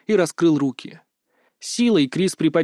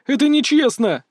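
high, indignant outburst; blurted protest; 10 semitones higher, 4 dB louder than usual. A narrator's reading. Tearful Berta objects to Kris's words, a high blurted protest. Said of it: "выпалила".

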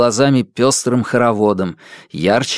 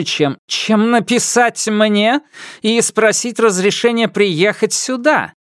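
high, slow mocking empress; 10 semitones higher than usual. A narrator's reading. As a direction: sneer as you cut him off. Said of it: "насмешливо перебила императрица".

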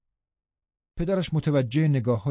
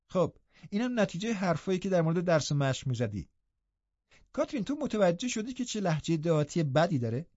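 higher, softer sneer; taunting young man; 6 semitones higher, 4 dB quieter than usual. Acted. Linton taunts Hareton in front of Catherine, high and soft. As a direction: needle him with a smirk.

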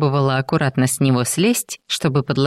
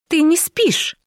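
high, surprised exclamation; surprised accusation; slower than usual. A narrator's reading. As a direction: catch him out with surprise. She catches him pretending to sleep.

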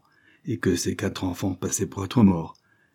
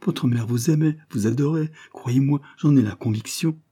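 higher-pitched, pained reassurance; understated anguished mother, pitched up; painful effort; 6 semitones higher than usual. A narrator's reading. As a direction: plead with the children through your hurt. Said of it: "soupira-t-elle avec un douloureux effort".

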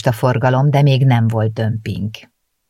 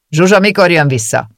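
louder, pushy baker voice; gruff baker; 5 semitones higher, 6 dB louder than usual. A narrator's reading. Baker asks again when Zsuzsa returns, loud. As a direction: demand it again. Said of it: "érdeklődött".

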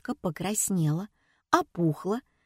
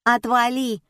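higher, insolent brush-off; bratty girl's rude retort; 5 semitones higher, 4 dB louder than usual. A narrator's reading. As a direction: tell them off cheekily. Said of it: "схамила Катя".

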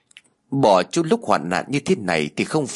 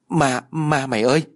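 higher-pitched mild yelp; yelping in fright; 5 semitones higher than usual. A narrator's reading. Friend seeing ghosts on the path, yelping in fright. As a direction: stammer out a scared cry for a friend. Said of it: "la lên oai oái".

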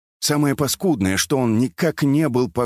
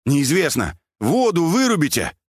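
raised, urgent shout; higher-pitched hollering; calling across; 7 semitones higher than usual. A narrator's reading. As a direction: yell an order over a distance.

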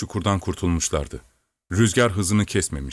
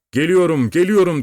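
high, loud repeated call; 9 semitones higher, 5 dB louder than usual. A narrator's reading. Servant answers the lost horse, calling out loudly. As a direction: call across a distance, pitched up high. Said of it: "diye seslendi ata".

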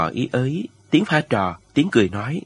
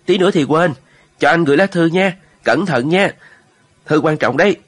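louder, higher call, as if short of breath; hổn hển gọi với; 5 semitones higher, 5 dB louder than usual. A narrator's reading. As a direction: call out urgently over a crowd. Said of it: "hỗn hển". (giọng hổn hển, gọi to và cao)

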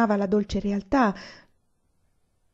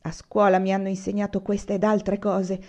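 ordinary delivery

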